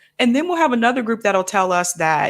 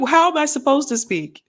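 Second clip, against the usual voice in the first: higher, incredulous comeback; baffled retort; 6 semitones higher than usual. Retold or imitated. Answering people who praise how they speak, incredulous. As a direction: throw the question back in disbelief. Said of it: "I'm like".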